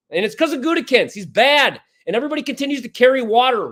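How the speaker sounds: usual speaking voice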